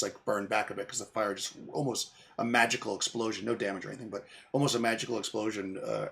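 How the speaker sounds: ordinary talk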